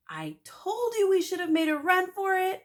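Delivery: put-on high, loud kid's shout; panicked kid shouting. A narrator's reading. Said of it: "I shouted".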